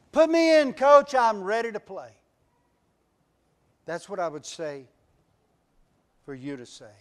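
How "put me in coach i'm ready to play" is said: high, loud plea to the coach; eager player begging coach. Shown as a demonstration. Player pleading with his coach, high and loud. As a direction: beg the coach to put you in, pitched up. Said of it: "Here's what I'd say".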